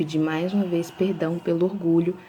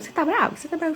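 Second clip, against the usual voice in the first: higher, nosy repeated question; imitating people asking; 11 semitones higher, much faster than usual. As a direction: mimic the curious askers, pitched up. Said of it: "as pessoas ficavam perguntando". (imitando as pessoas perguntando, mais agudo)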